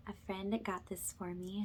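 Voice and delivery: usual speaking voice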